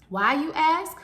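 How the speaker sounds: high, rising storyteller's question; rhetorical question. Pretend. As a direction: pitch it up, teasing the listener with the question. Voices the audience's question, storytelling.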